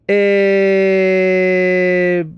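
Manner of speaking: usual speaking voice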